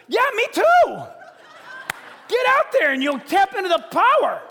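loud, high, laughing shout; God shouting. Retold or imitated. Preacher voicing God urging believers out, shouting. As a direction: boom it out with a laugh. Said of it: "God says".

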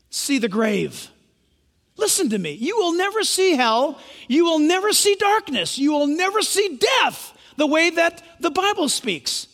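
normal talking voice

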